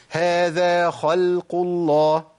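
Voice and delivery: slow, careful خلق with a light lam; slow recitation. Shown as a demonstration. Teacher slowly recites an example for the class. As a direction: recite هذا خلق الله slowly, lam kept thin. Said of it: "اللام مش مفخمة".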